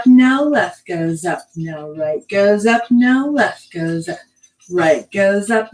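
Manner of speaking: drawn-out, rhythmic calls on the beat; dance cueing. Shown as a demonstration. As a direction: stretch the cues to fit the movement. Cues moves to the music.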